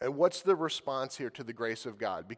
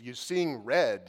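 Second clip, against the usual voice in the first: slow, louder, each word pressed; slow, weighted emphasis; 4 dB louder, slower than usual. Shown as a demonstration. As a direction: lean hard on seeing red. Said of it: "He's indignant".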